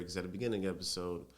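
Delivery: ordinary talk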